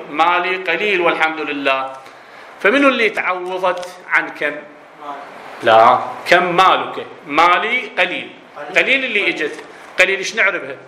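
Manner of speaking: ordinary talk, nothing marked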